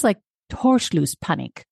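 slow, careful German "Torschlusspanik"; German pronunciation. Shown as a demonstration. Host gives cohost the real word in careful German. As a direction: say "Torschlusspanik" slowly, German-style. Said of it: "It's the word in German".

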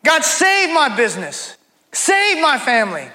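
high, drawn-out plea on each SAVE; imploring God, falling away at each end; crying out to God. Acted. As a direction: cry out a desperate prayer, stretching the words. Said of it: "You'll cry out to God".

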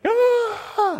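high, loud mock yelp of a scared kid; imitating the boy's alarmed cry at the blood; mock child scream. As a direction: yelp like a startled four-year-old.

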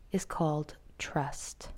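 usual speaking voice

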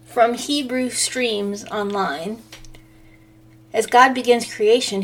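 ordinary delivery

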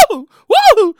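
imitated panicky whoop; two mock howls from a frantic mom; nervous hound howl. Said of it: "nervous hound".

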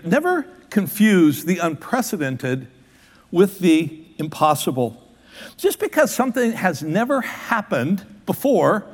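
slow, every word weighed; slow, deliberate repeat. Shown as a demonstration. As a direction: let it sink in, word by word. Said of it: "let that soak in".

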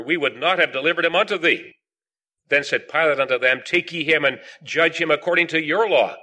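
measured, projected Bible reading; scripture reading. Acted. Preacher reading the Gospel of John to the meeting, solemn scripture reading. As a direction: read the verses out firmly to a congregation. Reads scripture aloud to congregation.

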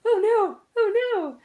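high, teary oh no; welling up, voice climbing high; touched. Retold or imitated. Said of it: "I can feel myself being like".

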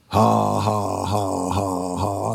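taunting laugh that trails off. Retold or imitated. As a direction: laugh right at the devil. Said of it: "I'm laughing at you, Mister Devil".

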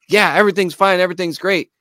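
hasty reassurance. Retold or imitated. Retold folks brushing off worried girlfriend.

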